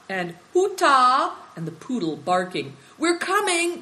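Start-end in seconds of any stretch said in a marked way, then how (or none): 0.5-1.3 high, loud summons; calling out. Acted. Seven Stars calling the boys home, calling loudly. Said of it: "calling alternately". 3.0-3.8 high, loud answering call; calling back. Acted.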